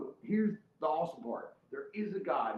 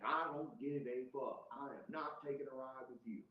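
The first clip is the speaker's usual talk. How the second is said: softer, measured refusal; defiant refusal; 10 dB quieter than usual. Retold or imitated. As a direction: quietly dig your heels in. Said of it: "And I'm like".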